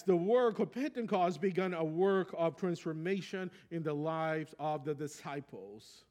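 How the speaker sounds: normal talking voice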